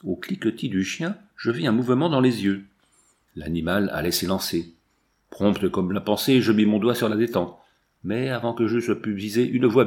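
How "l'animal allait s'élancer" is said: usual speaking voice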